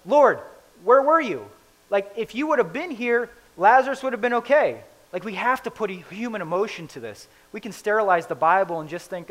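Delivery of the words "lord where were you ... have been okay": higher, louder, upset pleading. Retold or imitated. Martha confronting Jesus about her brother, upset and loud. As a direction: let her hurt and reproach spill out. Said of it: "in probably a tone that's upset".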